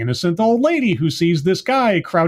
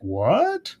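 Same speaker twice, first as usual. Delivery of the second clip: baffled; 2 semitones higher, 4 dB quieter than usual. Retold or imitated. Recapping the show, acting out the hero's baffled reaction. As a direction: imitate a puzzled double take.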